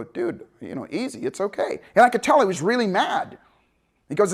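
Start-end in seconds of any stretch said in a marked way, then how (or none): 0.0-1.8 soft, low soothing; calming. Retold or imitated. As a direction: settle him down gently. Calming the angry instructor.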